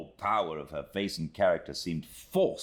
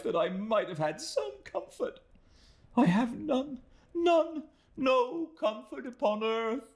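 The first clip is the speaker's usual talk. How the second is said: put-on much higher, pleading young woman's voice; pleading exclamation. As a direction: beg for mercy in a high, fragile young woman's voice. Emily laments.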